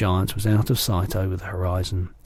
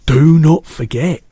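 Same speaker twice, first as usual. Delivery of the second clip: louder, slower friendly giant voice; a big kindly giant chatting; booming gentle giant; the BFG; 6 semitones higher, 8 dB louder, slower than usual. A narrator's reading.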